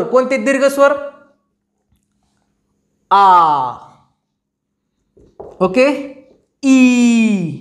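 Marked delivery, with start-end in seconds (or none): 3.1-3.7 "आ" held long and lower; long vowel. Shown as a demonstration. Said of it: "लांब असतो". 6.6-7.5 "ई" stretched out long; long vowel. Shown as a demonstration. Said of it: "उच्चार करायला वेळ लागतो".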